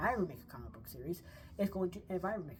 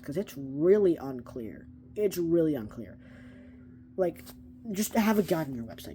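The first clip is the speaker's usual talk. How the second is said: loud, insistent appeal to the company; exasperated shouted request; emphatic plea; 2 semitones higher, 14 dB louder than usual. Real, not acted. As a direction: raise the voice and demand it. Calling out to DC.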